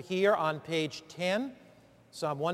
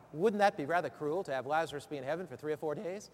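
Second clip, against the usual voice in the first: normal talking voice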